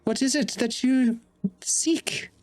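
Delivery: loom over the intruder with each word; menacing sorceress. Acted. Sorceress demanding why the sneaky one intrudes, high and menacing.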